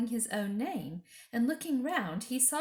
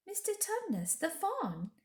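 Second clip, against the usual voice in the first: put-on higher, earnest girl telling a story; higher, earnest little girl; young girl's voice; 12 semitones higher than usual. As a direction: tell it wide-eyed and eager. Lucy speaks.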